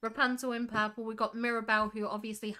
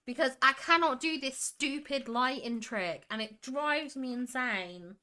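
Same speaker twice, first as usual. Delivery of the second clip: usual speaking voice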